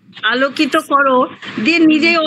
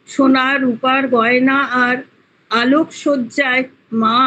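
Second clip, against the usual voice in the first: measured poetry recital with pauses between verses; poem recitation. Acted. Student reciting her Diwali poem to the class, poem recitation. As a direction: recite it line by line, pausing after each.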